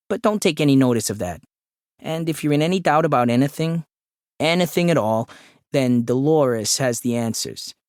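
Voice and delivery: nasal, eager little colleague; chummy office confidant. A narrator's reading. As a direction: confide the office lore to a newcomer. Sam confides in the newcomer.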